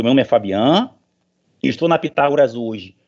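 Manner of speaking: usual speaking voice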